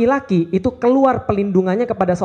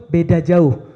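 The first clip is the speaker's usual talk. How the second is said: ordinary talk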